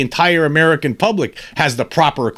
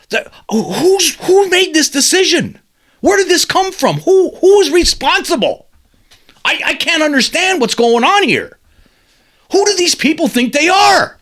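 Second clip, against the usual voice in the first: exasperated; 11 semitones higher than usual. Real, not acted. The host demanding who is responsible, outraged. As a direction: hammer each question in outrage.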